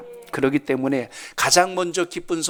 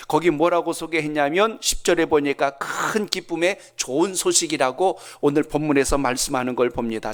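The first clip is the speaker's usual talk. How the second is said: ordinary talk, nothing marked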